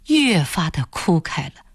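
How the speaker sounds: usual speaking voice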